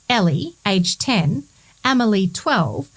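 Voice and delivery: ordinary talk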